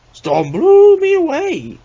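high, slow, loud sing-song howl; wailing clown voice. A narrator's reading. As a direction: wail it out like a mad clown. Clown wails from the drain.

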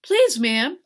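loud, high-pitched plea; pleading. A narrator's reading. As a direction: plead urgently with the mistress. Servant pleads with furious mistress.

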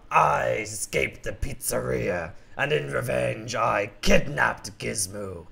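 slow, low gloating lion villain; Mister Kibbles gloats over his revenge. Acted. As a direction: lay out the crime slowly, savouring it.

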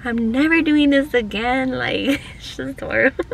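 half-laughing retold meltdown; exasperated laughing vent. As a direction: vent it loudly, then crack up laughing. Venting to husband about breakdown.

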